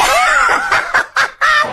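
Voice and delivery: squealing high laugh. Real, not acted. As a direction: burst into a shrill giggle. Laughs at own mix-up.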